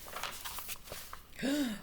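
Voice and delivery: a breathy hushed gasp of alarm; soft gasp. Acted. Reader to children, soft gasp of alarm. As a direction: gasp as if a monkey just vanished. Monkey goes missing.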